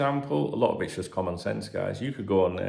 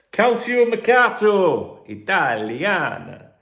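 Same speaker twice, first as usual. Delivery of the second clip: high, loud and slow, with a hammed-up Italian accent; mock Italian; 11 semitones higher, 10 dB louder, much slower than usual. Podcaster reading the Italian source name, mock Italian accent. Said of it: "I am a jack of all trades".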